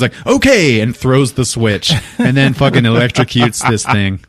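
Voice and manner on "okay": high, loud okay; imitated hurried call back; yelled acknowledgement. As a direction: shout back that you heard. Durkin yells back.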